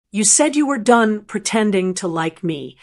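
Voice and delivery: ordinary delivery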